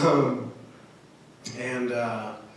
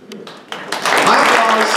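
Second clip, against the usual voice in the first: My applause stretched very slowly and loud; drawn-out applause; 4 semitones higher, 4 dB louder, much slower than usual. Shown as a demonstration. Comic savoring his applause, drawn out slowly. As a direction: drag out applause like a grand announcement.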